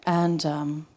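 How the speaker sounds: ordinary talk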